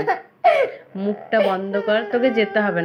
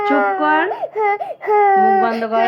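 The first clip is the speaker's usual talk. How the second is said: high, loud order to shut up; angry yell; 11 semitones higher, 4 dB louder than usual. Real, not acted. Yelling at child to shut up.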